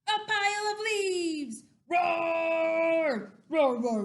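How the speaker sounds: loud, high announcement into big roars; hammed-up storybook roaring; dinosaur battle cry. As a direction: shout the challenge, then roar at it. Dinosaur takes on a challenge.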